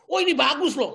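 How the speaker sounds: hammed-up eager listener; mock gullible excitement. Preacher mocking a gullible listener, gushing loudly.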